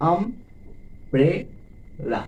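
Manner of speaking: "Umbrella" very slowly, আম ... ব্রে ... লা; teacher counting syllables in umbrella. Shown as a demonstration. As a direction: split umbrella into three beats. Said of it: "তিনবার স্পর্শ করছে".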